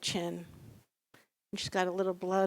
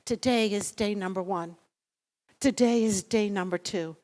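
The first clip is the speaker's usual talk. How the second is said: ordinary talk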